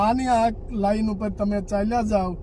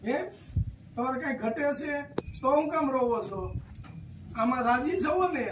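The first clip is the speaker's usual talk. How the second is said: gentle consoling; 4 semitones higher, 7 dB quieter, faster than usual. Real, not acted. Visitor comforts a crying elderly woman, softly coaxing. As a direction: soothe her like calming a grandmother.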